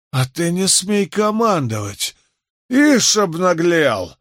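high-pitched, outraged bully; indignant outburst, ending drawn out; indignant rage. Acted. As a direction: put him in his place, high and furious. Osley rages at being ordered.

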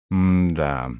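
drawn-out doubtful hum; distrustful. A narrator's reading. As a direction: let the doubt hang on one long syllable. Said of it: "всё ещё недоверчиво сказал Халас". (недоверчиво)

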